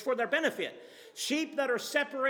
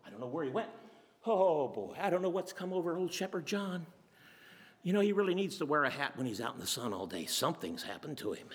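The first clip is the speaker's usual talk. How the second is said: lower, softer grumbling of a bewildered neighbor; mutter, shaking your head at a crazy friend; a sleepy neighbor puzzling over Shepherd John; puzzled old neighbor; 6 semitones lower, 3 dB quieter, faster than usual. Retold or imitated. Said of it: "He probably shuffled to the door".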